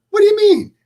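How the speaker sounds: very high, loud squeal of protest; mock-outraged high yelp; indignant protest. Acted protest to his instructor.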